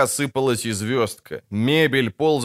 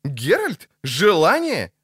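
incredulous, high rising questions; high-pitched amazed queries; astonished; 6 semitones higher, slower than usual. Acted. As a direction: blurt both words in disbelief. Lutik stunned by the wish.

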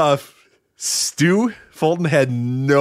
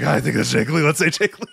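Mark the commentator. Retold or imitated. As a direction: play an unsure announcer settling on a name. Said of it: "Mark was like".

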